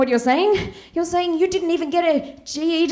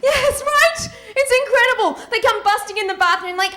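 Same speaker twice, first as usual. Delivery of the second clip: ordinary talk, nothing marked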